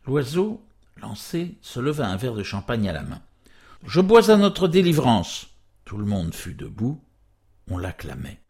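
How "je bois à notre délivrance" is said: high, loud celebratory proclamation; exultant raised-voice cheer; rousing toast. A narrator's reading. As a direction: call out a toast to the whole table. Tipsy man raises a toast.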